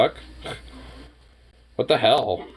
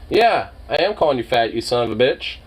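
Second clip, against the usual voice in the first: ordinary talk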